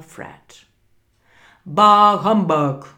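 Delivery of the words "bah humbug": put-on grumpy old miser. Scrooge's grumpy retort.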